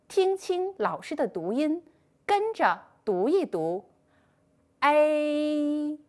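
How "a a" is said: A held long and even, a gliding smoothly into i; drawn-out pinyin ei. Shown as a demonstration. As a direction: sustain A as a careful model for pupils.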